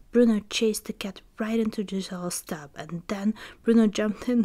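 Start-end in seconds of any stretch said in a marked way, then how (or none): none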